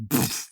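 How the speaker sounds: voiceless burst of air for a crash; imitated impact puff. Game master showing the player's smash landing, puffed sound effect. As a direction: puff out the sound of hitting ground.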